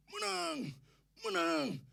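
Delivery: high, drawn-out victory yell; imitated children's shouting. Preacher imitating children outside his house, high shout. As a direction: cry it out like a gang of kids. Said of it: "Mereka belasan orang teriak".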